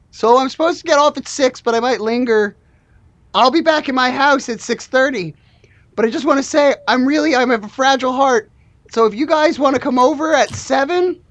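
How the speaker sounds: needy oddball employee making plans; Shaggy-voiced worker. Plays needy worker making a date.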